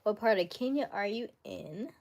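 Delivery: ordinary talk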